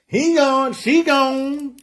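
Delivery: high-pitched mimicked catchphrase, twice over; video guy catchphrase. [name] mimics the shoplifter-video guy for Jimmy, high catchphrase imitation. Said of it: "That's exactly who I'm talking about".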